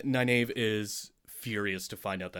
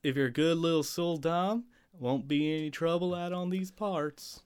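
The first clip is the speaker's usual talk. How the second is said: put-on Texas accent; 6 semitones higher than usual. Co-host keeps the joke going in a Texas accent. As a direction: play a mock Texas sheriff. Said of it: "it's supposed to have a Texas accent".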